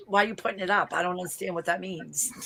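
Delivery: ordinary delivery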